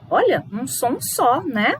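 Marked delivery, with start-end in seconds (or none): none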